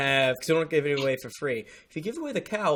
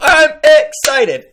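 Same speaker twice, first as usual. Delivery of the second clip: excited; 12 semitones higher, 11 dB louder, much slower than usual. Acted. Recording starts, hyped open.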